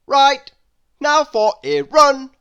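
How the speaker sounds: loud, high brisk command; bossy order. A narrator's reading. Mister Busy loudly ordering Lazy to go running. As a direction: snap him into action. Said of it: "Mister Busy said".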